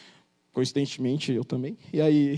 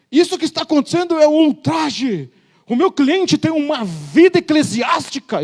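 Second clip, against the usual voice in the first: loud, slow and grandiose courtroom plea; pompous lawyer; 8 semitones higher, 8 dB louder than usual. Retold or imitated. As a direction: orate like an outraged attorney.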